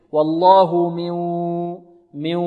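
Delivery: slow من melting into a held nasal w after والله; nasal merging. Shown as a demonstration. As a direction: hum the ghunna on من through the nose. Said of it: "الغنة". (إدغام بغنة في من)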